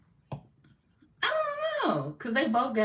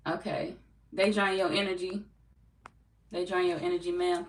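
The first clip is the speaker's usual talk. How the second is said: ordinary delivery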